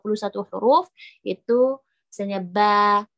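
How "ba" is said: "ba" with a thin, light vowel; flat-tongue ba. Shown as a demonstration. Teacher models a letter without raised tongue, thin ba. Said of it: "lidah kita datar".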